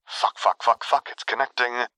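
hushed, panicky muttering; frazzled muttering. A narrator's reading. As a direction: mutter it like your nerves are shot. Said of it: "he heard Quinn mutter into the comm, sounding very much like his nerves were entirely shot".

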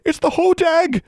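high, loud terrified gasp. A narrator's reading. Wade cries out at the monster, terrified. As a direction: gasp it in terror. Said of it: "gasped Wade".

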